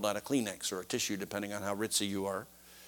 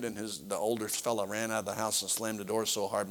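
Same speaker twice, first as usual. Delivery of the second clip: normal talking voice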